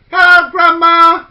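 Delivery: put-on loud, high, drawn-out children's hello; bright children's cheer; excited greeting. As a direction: call out delighted to see grandma. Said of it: "they all said".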